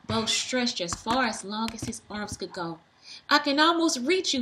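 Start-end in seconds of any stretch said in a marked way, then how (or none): 3.3-4.4 higher, louder straining yell. A narrator's reading. Bo yelling up to Uncle Ned, loud. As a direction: call up while reaching. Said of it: "yelled Bo".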